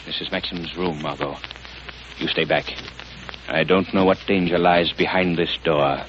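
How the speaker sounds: low, hushed, secretive and menacing; the hidden avenger speaking softly in the dark; the Shadow's hushed voice. Acted. Lamont becomes the Shadow, hushed.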